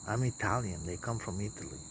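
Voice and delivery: usual speaking voice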